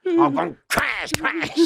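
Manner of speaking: high, slow, relishing the hit; gleeful punch. Retold or imitated. Telling how he knocked out the gang member, gleeful high shout. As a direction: savour each blow. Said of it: "crash out your farmer".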